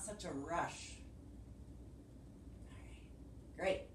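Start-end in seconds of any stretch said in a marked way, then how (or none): 2.7-3.8 hushed whisper to the class. Real, not acted. Teacher whispering to calm children, hushed. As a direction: breathe it softly so nobody startles.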